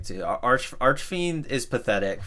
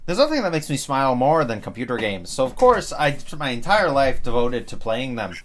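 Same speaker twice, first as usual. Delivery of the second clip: mock enthusiasm; 5 semitones higher, 6 dB louder than usual.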